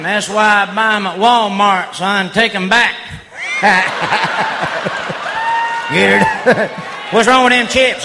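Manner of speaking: ordinary delivery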